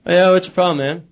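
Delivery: lightly voiced cheeky wife; casual wife voice. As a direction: toss it off like a hip modern wife. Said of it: "she says".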